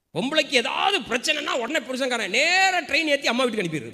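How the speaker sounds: loud, high-pitched rant; indignant preaching. Real, not acted. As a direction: rail at the husbands from the pulpit. Preacher rants at neglectful husbands.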